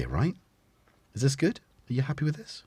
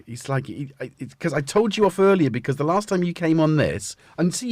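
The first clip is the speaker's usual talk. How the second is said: ordinary talk, nothing marked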